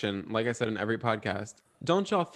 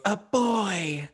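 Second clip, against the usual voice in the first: higher, swooning witch voice; Sarah Jessica Parker witch; 7 semitones higher than usual. Retold or imitated. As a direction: coo it like a boy-crazy witch. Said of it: "she goes".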